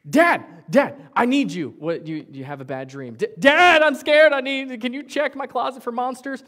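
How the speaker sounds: put-on high, loud frightened child's cry; crying out scared. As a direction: scream for dad after a bad dream. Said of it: "you're like".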